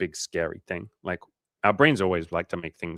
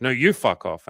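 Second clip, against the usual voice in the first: higher, louder mock comeback; quick, raised-pitch retold retort; snappy retort; 4 semitones higher, 6 dB louder than usual. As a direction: throw it right back, imitating her. Retold back-and-forth, her turn.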